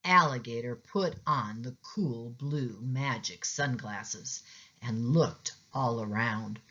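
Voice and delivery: ordinary delivery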